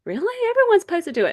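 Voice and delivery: high, loud mock incredulity; incredulous. Church lady insisting everyone gets the flu shot. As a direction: squeak out her disbelief. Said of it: "lady's reply".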